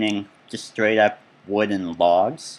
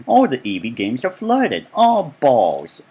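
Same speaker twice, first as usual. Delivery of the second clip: higher, louder mock exclamations; quoted gripes, pitched up and punched; reviewer impression; 4 semitones higher, 4 dB louder, slower than usual. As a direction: imitate a game reviewer cursing in exasperation. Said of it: "the guy's little voice is in my head".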